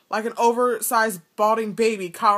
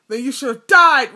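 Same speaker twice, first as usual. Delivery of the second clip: louder, forceful roar; 3 semitones higher, 7 dB louder than usual. A narrator's reading. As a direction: throw it down like a verdict.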